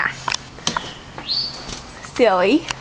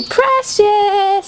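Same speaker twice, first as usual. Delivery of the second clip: sing-song call; 7 semitones higher than usual. Shown as a demonstration.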